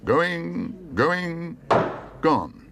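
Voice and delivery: high auctioneer's going, going, gone; auctioneer calling. Acted. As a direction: drop down hard on gone. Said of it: "called the auctioneer".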